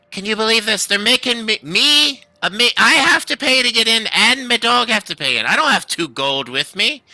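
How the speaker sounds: high, indignant halfling complaining, then a friendly hi; the small halfling venting to strangers; exasperated halfling. Acted. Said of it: "looks towards you all and says".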